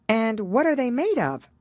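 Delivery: put-on high girlish voice, eager to know; Alice asking with great curiosity; curious Alice. A narrator's reading. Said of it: "in a tone of great curiosity".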